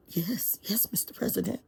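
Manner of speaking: soft whispered yes-man; meek whisper. Acted. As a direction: whisper it like you're scared of him. Timid lawyers agreeing meekly.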